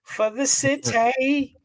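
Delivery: high, stretched-out call; drawn-out hype call. Pretend. Co-host finishing the deacon's introduction, high drawn-out call. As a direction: draw it out high, playfully. Hands over for the city segment.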